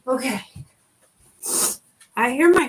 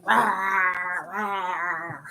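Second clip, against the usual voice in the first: a growly mock "rah, rah" for the dog; dog growl imitation; 4 semitones lower, 3 dB quieter than usual. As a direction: imitate a grumpy pup. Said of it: "She's just like".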